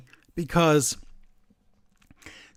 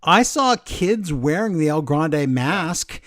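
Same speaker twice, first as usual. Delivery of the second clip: slow, drawn-out quoted correction; retold pointed rebuttal; 3 semitones higher, 3 dB louder, slower than usual. Retelling a commentator rebutting his partner, slow and pointed. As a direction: spell it out slowly for him. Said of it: "Graves goes".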